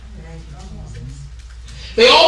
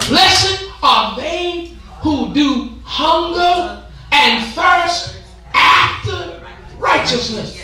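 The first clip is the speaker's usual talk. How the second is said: slow preached verse; 4 semitones higher, much slower than usual. Acted. Scripture slowly drawn out.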